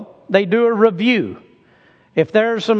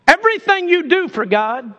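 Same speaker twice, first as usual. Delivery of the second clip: ordinary delivery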